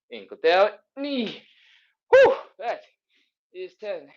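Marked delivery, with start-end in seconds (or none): 2.1-2.8 relieved whew. Real, not acted. Exhale after a hard set.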